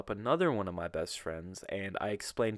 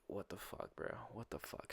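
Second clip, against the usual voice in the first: very soft, near-whispered aside; hushed disbelief; 16 dB quieter than usual. Retold or imitated. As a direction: say it under your breath. Muttered thought in disbelief.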